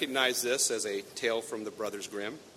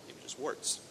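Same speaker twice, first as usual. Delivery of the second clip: wry whisper; 3 semitones higher than usual. Real, not acted. Wry aside to congregation.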